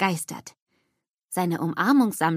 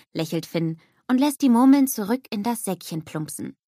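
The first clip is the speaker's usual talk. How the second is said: plain reading voice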